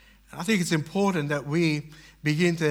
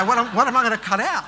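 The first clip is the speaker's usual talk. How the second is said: exasperated question; 5 semitones higher, 5 dB louder, faster than usual. Retold or imitated. Anxious budgeting, laughing at himself.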